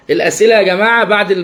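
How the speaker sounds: ordinary delivery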